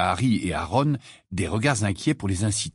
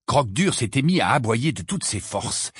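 usual speaking voice

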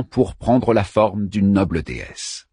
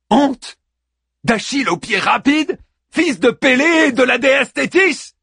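high, loud, proud protest; indignant outrage; 13 semitones higher, 3 dB louder than usual. Acted. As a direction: fling back your titles. Achilles indignant.

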